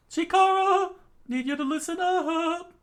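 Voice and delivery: very high and loud; high urgent voice. Acted. Character calling Chikara to listen, high loud urgent voice. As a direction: call him to attention.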